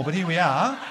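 ordinary delivery

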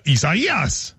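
Isaias very slowly, stressing the third syllable; drawn out Isaias. Shown as a demonstration. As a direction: stretch out Isaias like a TV forecaster. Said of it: "I expect him to be on T V going".

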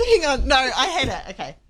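ordinary talk

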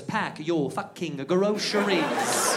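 put-on very slow, word by word in British accent; drawn-out British order; British man repeating himself. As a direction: spell it out slowly as if to an idiot. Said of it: "And he goes".

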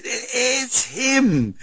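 high, slow, awestruck gasp of it's him; stunned disbelief. Retold or imitated. Wife hands over the phone in the night, awestruck and high. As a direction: say it as if you can't believe who's calling.

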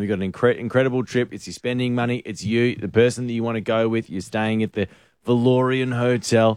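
host selling the prize package; live radio prize plug; radio ad read. Acted. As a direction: pitch the hotel and price like a promo. Reads prize details live.

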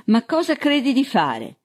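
high-pitched protesting question; indignant exclamation. A narrator's reading. Man protesting to the sheriff holding a gun on him, indignant. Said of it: "esclamò".

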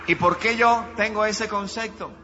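loud, rising declaration of faith; fiery loud preaching; preaching at full force. Acted. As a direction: proclaim it to the whole church. Preacher declares long healthy life.

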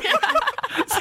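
high bright laugh bursting out. Real, not acted. Telling mates a funny yarn, high laugh.

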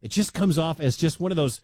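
usual speaking voice

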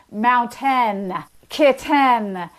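kitten with a crisp, over-careful T; hypercorrect T. Shown as a demonstration. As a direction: overpronounce the T on purpose. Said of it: "That goes too far the other way".

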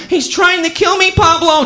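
high, loud damsel in distress; hammed-up plea for rescue; panicked cry. Terrified victim cries to detective.

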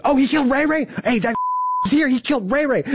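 high-pitched mock yell across the yard; retold yell. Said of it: "someone else yells".

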